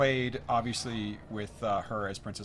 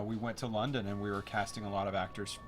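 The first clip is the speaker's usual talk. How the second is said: ordinary talk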